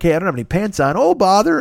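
gentle Pooh. Retold or imitated.

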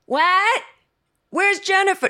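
very high-pitched mom impression; shrieky mock parental holler. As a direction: imitate mom shouting across the house. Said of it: "And then my mom goes".

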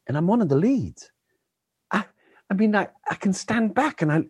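put-on young boy marvelling at being in a Disney movie; imitating a child. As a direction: play the awestruck kid in the movie. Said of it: "one of the children was telling me".

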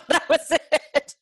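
breaking into high laughter on the words; laughing. Real, not acted. Laughing while retelling a reconciliation.

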